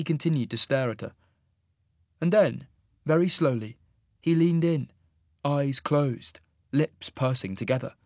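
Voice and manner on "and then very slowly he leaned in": plain reading voice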